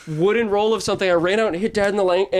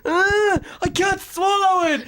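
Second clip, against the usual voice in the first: put-on whiny child; 13 semitones higher, much slower than usual. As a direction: wail it like a fussy kid. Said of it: "imitating sister's childish whine".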